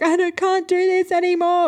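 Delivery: high, whiny imitation of a child; whinging. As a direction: mimic a tired daughter whinging. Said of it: "It was more like whinging".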